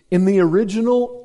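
normal talking voice

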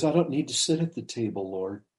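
soft, meek retold appeal; humble plea. Woman humbly declines a seat at table, meek. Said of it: "She's humble".

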